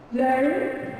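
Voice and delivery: American "learn" with a sounded R; American accent. Shown as a demonstration. Teacher sets up contrast with British learn, American accent. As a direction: curl the R in "learn", American style. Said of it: "the British is gonna say learn".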